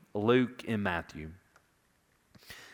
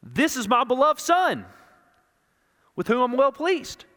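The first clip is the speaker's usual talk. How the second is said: louder, higher, announcing to a crowd; public proclamation; 14 semitones higher, 8 dB louder than usual. Retold or imitated. The Father announcing his Son to the crowd, loud proclamation. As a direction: proclaim it to everyone listening. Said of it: "They're hearing the Father say".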